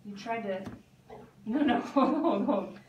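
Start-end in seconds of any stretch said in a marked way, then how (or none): 1.4-2.8 louder laugh-broken no, no, hold; trying to give orders through a laugh; laughing commands. Real, not acted. Trainer laughing while telling the puppy to hold.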